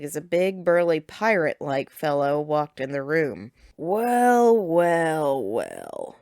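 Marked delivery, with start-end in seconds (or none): 3.8-6.2 smug, sinking drawl; smug villain. A narrator's reading. Villain appears and gloats.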